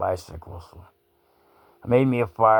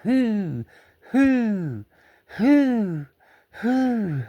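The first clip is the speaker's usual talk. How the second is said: high hooting coo, much higher; dove hooting; 13 semitones higher, much slower than usual. Retold or imitated. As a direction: imitate a dove cooing hoo hoo. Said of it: "all I heard was".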